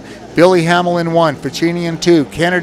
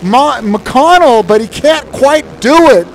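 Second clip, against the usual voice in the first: excited; 8 semitones higher, 5 dB louder than usual. Real, not acted. Close pass attempt in the race.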